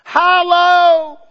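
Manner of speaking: long drawn-out high wail of Hollow; mock heavenly holler; loud cry. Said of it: "cried with a loud voice".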